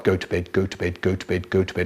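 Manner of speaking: low, soft monotone chant; droning nagging brother. Retold or imitated. Older brother endlessly ordering sibling to bed, low nagging drone. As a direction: drone it like a stuck record.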